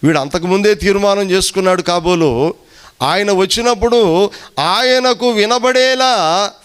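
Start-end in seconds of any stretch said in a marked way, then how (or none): none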